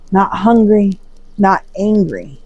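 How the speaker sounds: usual speaking voice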